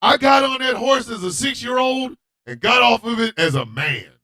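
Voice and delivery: ordinary talk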